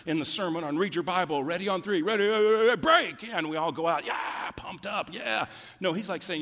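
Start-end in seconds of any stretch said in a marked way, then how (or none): 1.4-3.2 loud, high mock locker-room chant; team huddle shout. Pastor mocking a hyped-up locker room huddle, loud shout. 4.1-5.5 high, slow mock pumped-up cheer; mock hype. Pastor mocking churchgoers pumped up after a sermon, hammed-up cheer.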